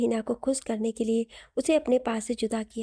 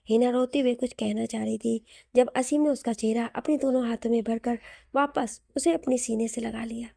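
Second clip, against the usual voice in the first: ordinary delivery, nothing marked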